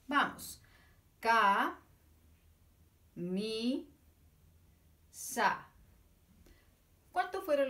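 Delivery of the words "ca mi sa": Ca, mi, sa spaced apart, one beat each; teacher claps out syllables with the puppet, Ca mi sa; clapped syllables. Shown as a demonstration. Ca, mi, sa clapped one by one. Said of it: "nos ayudas con los aplausos".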